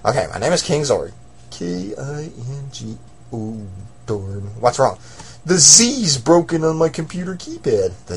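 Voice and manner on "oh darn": ordinary talk, nothing marked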